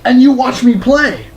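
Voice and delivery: loud, bright-energy host greeting the viewers; upbeat show host intro. Acted. Host welcoming Game Boys and Game Girls, big energetic greeting. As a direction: hype the show open like a TV host.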